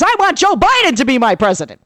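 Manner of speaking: a husband raving about the vice presidential debate, excited; thrilled husband. Retold or imitated. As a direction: gush like a fan after a great show. Husband gushing about Biden.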